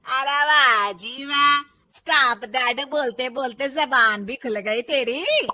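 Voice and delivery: loud, slow, mocking saas; scornful elder mocking her bahu's nerve; sarcastic mother-in-law.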